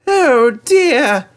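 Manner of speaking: high, loud voice of a sad engine; sad little engine. Acted. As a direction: sigh it out as a lonely little engine. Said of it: "he thought sadly".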